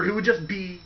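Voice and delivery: ordinary talk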